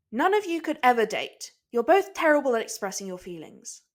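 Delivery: loud, exasperated put-down; blunt verdict. Acted. Nami loudly telling both men off. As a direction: shut them both down.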